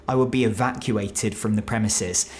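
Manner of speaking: ordinary talk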